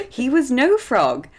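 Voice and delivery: usual speaking voice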